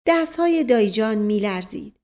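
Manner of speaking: ordinary delivery, nothing marked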